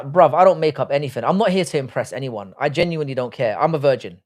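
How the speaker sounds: normal talking voice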